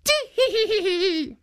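high tittering granny giggle; high tee-hee giggle; old woman giggle. Acted. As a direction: giggle helplessly like a little old lady. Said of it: "could not help laughing".

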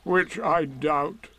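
slow, doubtful donkey; Eeyore. A narrator's reading. Eeyore doubts the morning is good, slow gloomy voice. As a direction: drag it out dolefully. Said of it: "said he".

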